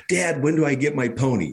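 a hint of a kid tugging at Dad; little girl. Daughter runs to dad after the goal, lightly voiced eager child.

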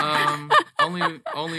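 breaking into a high laugh. Real, not acted. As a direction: giggle through the words. Teased about his accent.

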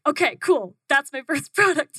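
high, loud happy decision; delighted. Retold or imitated. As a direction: announce it with a laugh. Happy with feedback.

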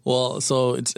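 ordinary delivery

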